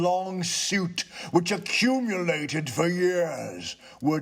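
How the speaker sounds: high, slow, lofty spirit; a solemn ghost warns him off; ghost's voice. Acted. Ghost warns the tenant about the poker, slow and eerie.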